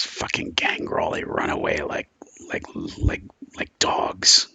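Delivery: low, creaky growl; growled contempt. Acted. Captive scorns the gangrel who ran away, growled contempt. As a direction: growl it with disgust. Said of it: "she kinda growls something".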